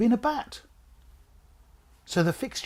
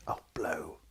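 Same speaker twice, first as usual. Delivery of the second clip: mild groan; 8 semitones lower, 3 dB quieter than usual. Acted. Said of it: "groaned George".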